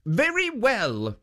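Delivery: high, firm guardian warning; stern statue. A narrator's reading. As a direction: protect her, pitched high and firm. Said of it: "said Jitso".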